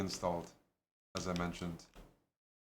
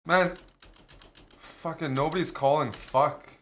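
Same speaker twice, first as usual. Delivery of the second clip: loud annoyed groan at teammates; vent, loud and fed up; frustrated outburst; 5 semitones higher, 6 dB louder than usual. Real, not acted.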